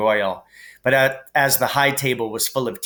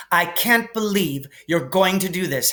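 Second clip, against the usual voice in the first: higher, prim scolding; lightly voiced bossy Hermione; 7 semitones higher than usual. Hermione scolds Harry and Ron, higher and prim. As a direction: tell them off primly.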